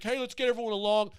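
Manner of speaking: a breezy hammed-up Biden line; mock Joe Biden. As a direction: naive cheery Biden voice, loud. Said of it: "Joe's like".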